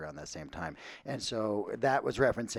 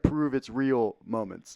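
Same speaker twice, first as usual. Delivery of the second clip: normal talking voice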